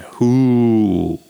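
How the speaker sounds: a loud booming "Huu!" right in his face; sudden boom. Retold or imitated. Boom to silence a chatty dandy at lunch, loud and sudden. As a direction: startle him with a loud hoot. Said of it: "i jak nie huknę".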